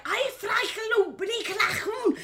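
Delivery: high, loud mock-German yelling. Retelling an actor's German monologue to friends, mock German yelling. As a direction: imitate a man shouting fake German. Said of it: "He just starts yelling in German".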